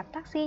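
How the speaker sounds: "taxi" with unaspirated t and two even syllables; Vietnamese pronunciation. Shown as a demonstration. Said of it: "trong tiếng Anh mình sẽ không đọc là". (đọc "taxi" kiểu tiếng Việt, hai âm tiết đều nhau)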